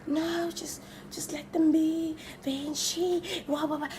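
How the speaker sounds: soft unvoiced whisper, slowing down; whispered calming. The guy whispering to her to let the girls be, soft whisper. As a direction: hush her and wave them off. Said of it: "this guy's just like".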